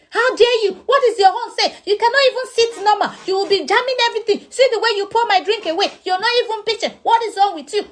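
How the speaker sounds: mock scolding. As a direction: overdo the angry friend, half laughing. Friend voiced scolding at party.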